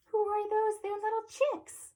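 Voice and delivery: high, singsong delight for little ones; excited child-directed question. Pretend. Reader points out chicks to children, high and excited. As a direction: coo it high to small children.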